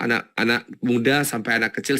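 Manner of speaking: ordinary delivery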